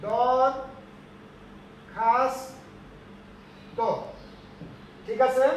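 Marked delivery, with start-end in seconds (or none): 0.1-3.8 দরখাস্ত split into দর, খাস, ত with long pauses; syllable by syllable. Shown as a demonstration. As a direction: break দরখাস্ত apart slowly for dictation. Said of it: "দরখাস্ত নয়". (ভেঙে ভেঙে দর-খাস-ত)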